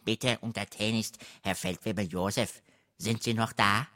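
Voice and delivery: servile, hissing polite question; unterwürfiges Zischeln. A narrator's reading. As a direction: ask humbly through the door, hissing. (unterwürfig zischelnde Frage)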